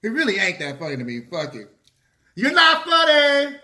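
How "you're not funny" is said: loud, slow, high yell; shouting. Real, not acted. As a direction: yell it right at him. Yelling at Kevin Hart.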